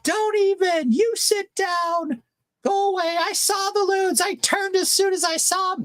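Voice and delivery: very high squeaky mock shout; brother-in-law yelling back. Brother-in-law yelling back at the boat lady, high squeaky mock. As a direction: squeak back in a cartoon yell. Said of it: "he starts yelling back".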